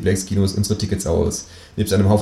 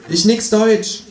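much higher mock broken German; fake foreigner; 13 semitones higher, slower than usual. Man pretending to be foreign to the tattooed thug. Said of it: "sage ich".

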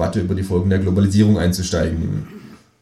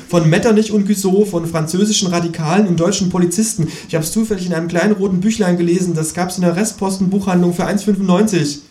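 high, plaintive ghost voice; high-pitched moaning ghost; persecuted ghost; 10 semitones higher, 3 dB louder than usual. Acted. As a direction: pour out the complaint in a raised voice. Ghost figure laments in story.

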